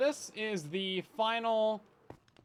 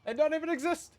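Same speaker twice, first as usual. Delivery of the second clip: exasperated; 10 semitones higher, 5 dB louder, faster than usual. Real, not acted. Laughing at own mistake.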